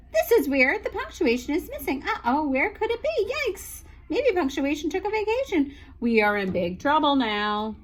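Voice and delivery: teacher reading without punctuation, flustered; rushed run-on panic. Acted. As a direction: rattle it off with no stops.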